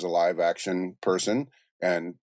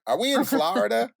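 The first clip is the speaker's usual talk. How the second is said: high, mock "Flah-rida" question; imitated movie line with exaggerated Florida; The Rock in Jumanji. Retelling the Jumanji line, high mock voice. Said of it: "when The Rock was like".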